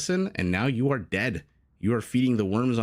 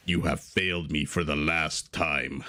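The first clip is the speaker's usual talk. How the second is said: deep, slow Darth Vader menace; low, slow voice of doom, lower than his own; James Earl Jones; 6 semitones lower, slower than usual. Retold or imitated. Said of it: "good James Earl Jones impression?".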